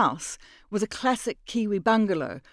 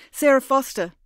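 Mira giving a fake name; 8 semitones higher, 8 dB louder than usual. Mira gives a false name.

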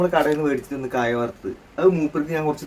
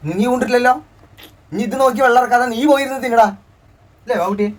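high, louder playful scolding; teasing; 10 semitones higher, 5 dB louder than usual. Real, not acted. Father teasing child about drooling, high and playful.

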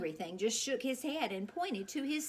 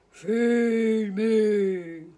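big booming monster voice, much louder; hungry monster demand; 11 dB louder than usual. Acted. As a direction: boom it out like a starving beast. Hungry monster points to sign.

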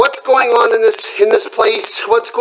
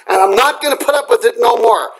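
ordinary talk

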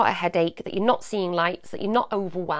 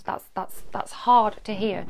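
usual speaking voice

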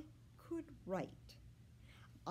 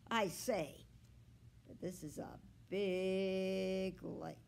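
ordinary delivery, nothing marked

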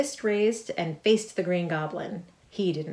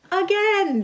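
much higher, louder delighted cheer; 14 semitones higher, 8 dB louder than usual. A narrator's reading. Two girls after the ride cheering for more. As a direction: shout with thrilled joy. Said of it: "they cheered".

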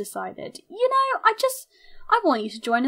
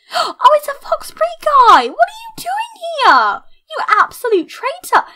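very high, loud squeal of shock; mock outrage; 13 semitones higher, 7 dB louder than usual. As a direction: shriek an accusation at a traitor in play. Spots own squad member at rival's den.

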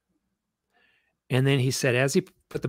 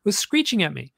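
higher, worked-up telling; excited retelling; 7 semitones higher, 3 dB louder than usual. Retold or imitated. Husband telling wife about the owl, worked up. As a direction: sound flustered about the owl. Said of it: "he tells his wife".

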